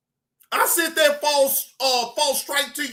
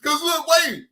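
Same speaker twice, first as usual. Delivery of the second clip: ordinary talk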